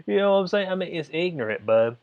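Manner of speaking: ordinary talk, nothing marked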